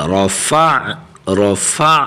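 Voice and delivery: رفع closing on a throaty ain; fa joined to ain. Shown as a demonstration. Teacher demonstrates joining, رفع ending on voiced ain. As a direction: join the fa straight into the ain in رفع. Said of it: "فا کی حرکت کو عین کے ساتھ ملائیں گے".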